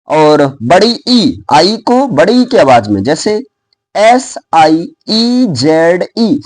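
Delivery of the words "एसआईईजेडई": letters of "एसआईईजेडई" dragged out very slowly; very slow spelling. Shown as a demonstration. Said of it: "सी को खींचा गया ना".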